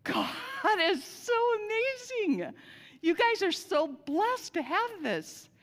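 high, loud, awe-struck praise; joyful exclamation. Retold or imitated. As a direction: burst out in delighted wonder at God. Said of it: "Richard raises his hands and says".

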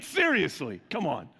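ordinary talk, nothing marked

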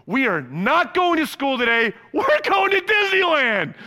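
loud, high announcement building to a shout; big reveal. Retold or imitated. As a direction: build to a huge excited payoff. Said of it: "and then here's the moment".

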